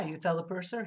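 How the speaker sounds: ordinary talk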